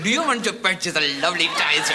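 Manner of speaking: put-on light Pakistani accent, salesman patter. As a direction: sell a tie in a Pakistani accent. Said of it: "speaks with a Pakistani accent".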